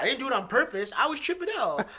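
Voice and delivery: defensive protest. Retold or imitated. Defending himself to his wife.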